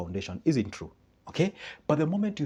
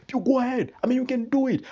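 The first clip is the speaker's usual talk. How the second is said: much higher, louder cheering; hyped-up friend; 12 semitones higher, 5 dB louder than usual. Retold or imitated. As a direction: cheer them on like a fan. Hype friend cheers you on.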